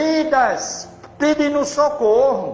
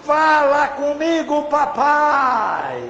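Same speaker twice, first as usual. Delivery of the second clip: imploring prayer; 3 semitones higher, slower than usual. Retold or imitated. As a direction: call out to Papai, stretching the words. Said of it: "eu disse, desculpa".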